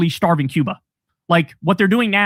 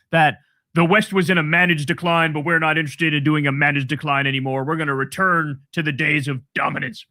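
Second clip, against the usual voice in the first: mocking Rubio; 5 semitones lower than usual. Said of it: "it's so ironic for Rubio to say".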